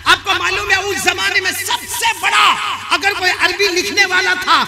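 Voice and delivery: high, insistent sermon voice; full-force preaching. Real, not acted. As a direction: roll out the list with rising fervour. (ऊँची आवाज़ में जोशीली तक़रीर)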